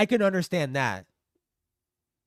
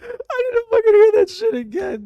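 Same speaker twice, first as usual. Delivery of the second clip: loud, high laughing shout; crying with laughter; laughing hard; 11 dB louder than usual. Real, not acted.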